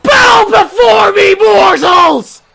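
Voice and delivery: high, slow booming shout; dark lord. Acted. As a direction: roar it like a villain king. Second gloat, full villain.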